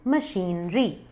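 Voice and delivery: मशीनरी as "muh-sheen-ree"; slow, split syllables in मशीनरी, long ee; correct pronunciation. Shown as a demonstration. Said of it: "correct pronunciation है". (मशीनरी को "मशीन-री" बोलें)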